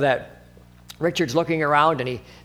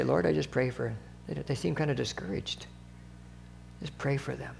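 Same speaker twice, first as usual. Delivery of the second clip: soft, murmured prayer fading softer; hushed prayer; 2 semitones lower, 12 dB quieter than usual. Retold or imitated. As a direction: pray under your breath for a stranger. Silent prayer for a stranger.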